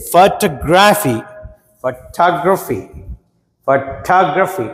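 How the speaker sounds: Photography slow, weight on టా; teacher drills photography hitting టా; stress on second syllable. Shown as a demonstration. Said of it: "టా మీద stress వస్తున్నది". (టా మీద ఒత్తిడితో నెమ్మదిగా ఫోటోగ్రఫీ)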